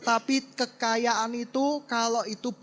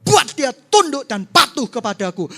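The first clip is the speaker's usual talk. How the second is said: louder, resentful demanding prayer; mock angry prayer; 2 semitones higher, 8 dB louder than usual. Preacher mocking a spiteful believer's prayer, loud and resentful. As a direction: spit out the grudge as a prayer. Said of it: "Jangan doa".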